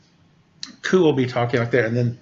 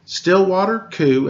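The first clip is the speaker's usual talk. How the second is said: plain reading voice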